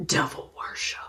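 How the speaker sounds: whispering devil worship; whispered quotes. Shown as a demonstration. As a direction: breathe it like a secret. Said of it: "have serious quotes around this".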